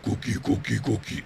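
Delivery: gruff Cookie Monster growl. Shown as a demonstration. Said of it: "for the Cookie Monster stuff".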